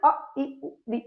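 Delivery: अ, इ, उ, ऋ एकदम छोटे और फुर्ती से; हर स्वर को झट से बोलकर छोड़ दो; झटपट ह्रस्व स्वर. Shown as a demonstration. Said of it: "एकदम से बोल गए ना". (ह्रस्व स्वर जल्दी-जल्दी बोलना)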